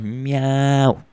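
playful meowing like a cat. Pretend. Podcaster playing the cat club, meowing. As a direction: meow like a lazy cat. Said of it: "haciendo como un gato".

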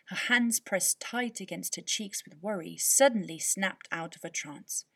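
ordinary delivery, nothing marked

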